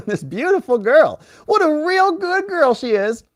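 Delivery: high-pitched, drawn-out cooing; gushing pet talk. Real, not acted. Praising a good girl on the stream, gushing coo. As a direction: gush in a high, sing-song coo.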